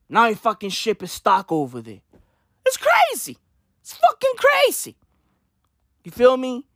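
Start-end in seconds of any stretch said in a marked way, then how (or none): 2.6-5.0 much higher, disbelieving cry; incredulous. Acted. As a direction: squeal it out in disbelief. Disbelief at stuck ship.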